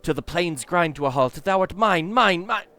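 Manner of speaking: loud, high demonic gloating; dark lord. Acted. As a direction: possessive evil overlord, building. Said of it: "must be the fiend's tongue".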